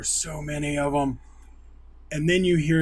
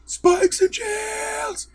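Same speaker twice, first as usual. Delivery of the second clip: battle cry; much slower than usual. Retold or imitated. Soldiers called out in battle.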